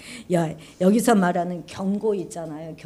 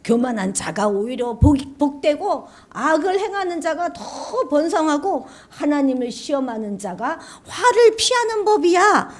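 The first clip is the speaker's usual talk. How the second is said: cynical complaint; 7 semitones higher than usual. Acted. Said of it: "이렇게 말하고 있다는 거예요".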